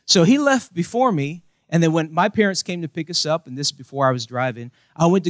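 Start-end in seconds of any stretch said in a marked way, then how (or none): none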